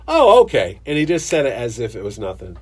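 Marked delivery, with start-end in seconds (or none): 0.1-0.8 high, loud "oh okay"; sudden startled reaction; surprised. Retold or imitated. Retold reply to odd answer.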